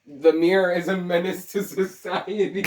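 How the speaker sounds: high, loud declaration breaking into laughter; high-pitched joke alarm dissolving into giggles; mock-dramatic outburst. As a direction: declare it hammily, then crack up.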